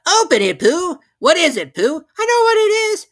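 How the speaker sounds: high, loud eager cries; excited little voices shouting; excited crowd. A narrator's reading. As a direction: call out all at once to Pooh.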